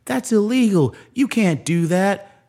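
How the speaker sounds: squeaky imitation of outraged people; indignant people. Mocking people who cry foul, high and outraged. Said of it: "A lot of people would say".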